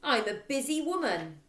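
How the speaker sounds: loud, bossy headmistress voice; harsh school tyrant demanding haste; headmistress Trunchbull. Acted. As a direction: bark it out impatiently.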